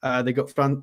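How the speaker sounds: usual speaking voice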